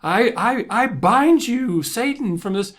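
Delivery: high, loud, slow hammed-up exorcism cry; mock preacher casting out demons. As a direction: rebuke the devil like a revival preacher, hammed up. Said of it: "they start casting out demons".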